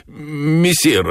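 high, put-on worried cat voice; falsely anxious. A narrator's reading. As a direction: feign alarm in a high voice. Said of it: "тревожно фальшивым голосом". (высоко, с фальшивой тревогой)

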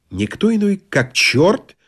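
usual speaking voice

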